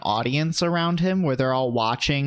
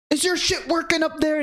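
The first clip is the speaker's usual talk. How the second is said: much higher put-on scolding; 13 semitones higher than usual. [name] scolds [name] while tapping his head, mock yell. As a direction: rant it like a fed-up rapper. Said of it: "he's like".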